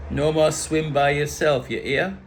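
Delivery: put-on islander warning; badly faked Caribbean scolding; bad Caribbean accent. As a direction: warn in a mock Caribbean accent. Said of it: "Bad accent".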